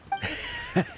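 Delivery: put-on a light, fond old man's chuckle; soft amused laugh. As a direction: chuckle kindly at an old friend. Old man greets Ganyu warmly.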